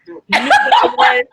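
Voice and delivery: high, loud, laughing indignation; mock indignant celebrity. Petty actor ranting in his trailer, high laughing indignation. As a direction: play the offended star, laughing.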